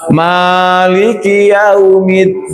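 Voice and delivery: slow chanted "Maliki yaumiddin"; Quran recitation. Shown as a demonstration. Teacher chanting the student's verse to correct it. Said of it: "Harusnya kan maliki".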